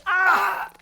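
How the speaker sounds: sudden high yelled scream. Acted. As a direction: shriek as the vines grab. Caught by grabbing vines.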